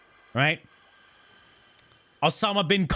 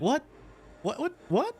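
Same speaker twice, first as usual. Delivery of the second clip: baffled disbelief; 9 semitones higher, 4 dB quieter than usual. Retold or imitated. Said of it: "I'm like".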